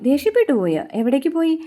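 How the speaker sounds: lightly acted alarm at the news; retold surprise. (അമ്പരപ്പോടെ ഉയർന്ന സ്വരത്തിൽ ചോദ്യം)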